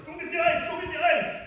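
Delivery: very high, full-volume retold scream; nurse's urgent yell. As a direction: yell an emergency order down the hall. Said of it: "The nurse yells".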